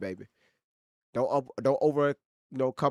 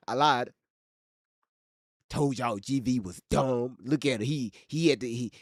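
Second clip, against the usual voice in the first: ordinary talk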